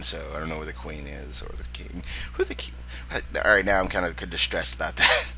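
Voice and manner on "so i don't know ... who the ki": much softer whisper, trailing off; confused old man whispering; whispered ramble. Acted. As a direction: mumble it to yourself in a whisper.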